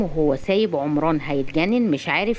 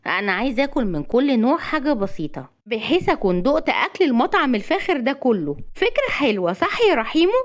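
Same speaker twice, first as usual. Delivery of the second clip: high-pitched female character voice; playful high-voiced wife; eager young woman; 6 semitones higher than usual. A narrator's reading. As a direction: pitch it high and climb to a coaxing question. Narrator voices excited young woman.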